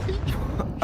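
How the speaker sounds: high breathy burst of laughter; laughing. Real, not acted. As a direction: laugh in disbelief. Caster laughs at play.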